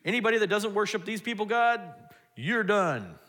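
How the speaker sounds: king's decree. Retold or imitated. King's decree after miracle.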